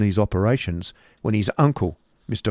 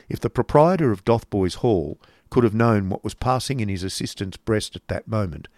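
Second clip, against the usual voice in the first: plain reading voice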